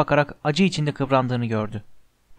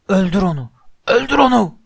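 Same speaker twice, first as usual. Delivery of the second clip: raised cry soaring into a loud high scream; screaming command; 6 semitones higher, 6 dB louder than usual. A narrator's reading. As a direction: shriek the order, higher on the repeat. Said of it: "diye haykırmasını işitebiliyordu". (haykırarak, gittikçe tizleşen emir)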